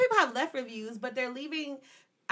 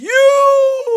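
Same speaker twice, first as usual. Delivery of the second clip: high, loud and drawn out; excited shout; 13 semitones higher, 17 dB louder than usual. Real, not acted. Excited about the party song.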